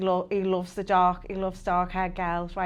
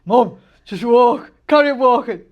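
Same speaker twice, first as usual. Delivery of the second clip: mock exasperated son; 12 dB louder than usual. Embarrassed son tells mum to keep walking, mock exasperation. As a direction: urge her along, mortified and emphatic.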